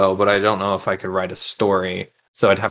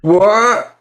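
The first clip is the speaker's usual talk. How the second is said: shocked woman; 12 semitones higher, 7 dB louder than usual. Retold or imitated. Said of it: "she's like".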